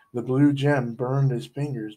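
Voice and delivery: plain reading voice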